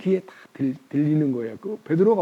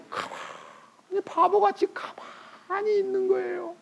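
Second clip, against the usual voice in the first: dismayed; 11 semitones higher, 7 dB quieter, slower than usual. Acted. Preacher voices disbelief.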